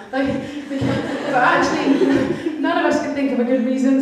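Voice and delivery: laughing while telling. Real, not acted. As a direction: talk through giggles. Laughs through story.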